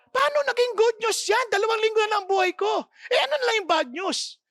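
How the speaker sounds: high, fast, alarmed disbelief; patient panicking at the news; shocked patient. Acted. (gulat na gulat na pasyente, mataas at mabilis)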